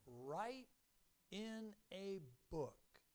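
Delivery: slow, spaced-out scripture words; deliberate emphasis. Acted. Preacher shows church the key command, slow and deliberate. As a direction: give each word room, slowly.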